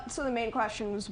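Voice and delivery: usual speaking voice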